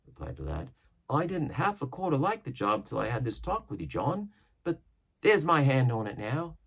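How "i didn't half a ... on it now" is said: put-on higher young lad voice; young sailor. Young seaman answering Silver, higher lad's voice. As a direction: sound green and doubtful.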